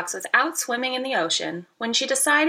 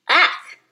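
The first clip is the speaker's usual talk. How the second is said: disgusted ugh; 5 semitones higher, 5 dB louder than usual. Acted. Disgust at bleachy water.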